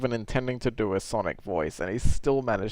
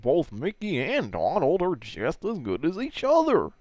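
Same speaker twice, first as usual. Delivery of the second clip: higher, wildly swooping cartoon dope voice; Goofy; 6 semitones higher than usual. Judging two cartoon characters, swooping goofy voice. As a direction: let the pitch lurch up and down goofily. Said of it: "That's my goofy voice".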